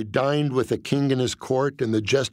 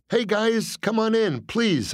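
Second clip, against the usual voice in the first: nervous; 9 semitones higher, slower than usual. Acted. Nervous welcome to mobsters.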